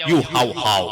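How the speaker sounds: low, muddled mimicry of learners; imitated children's answer. Teacher mimicking children's jumbled reply, low fumbling voice. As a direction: fumble it back like a confused pupil. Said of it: "Anak-anak jawab".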